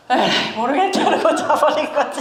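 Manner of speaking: a laughing, careless shrug of a line; Elijah giving up with a laugh. Retold or imitated. Preacher mimics a weary prophet dropping back to sleep, laughing and careless. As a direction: toss it off like you've given up.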